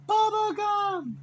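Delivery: high, drawn-out announcing yell; cop calling over PA. Retold or imitated. Cop calls the lost dog on the PA, yelling. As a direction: imitate a long high call over a loudspeaker. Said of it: "the cop gets on the P A".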